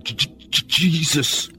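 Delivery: stuttering, unvoiced j's breaking into a high Jesus; haltingly stammered prayer; stammered mutter. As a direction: imitate her stuck on the first sound, then push the name out. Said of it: "shook her head, and muttered".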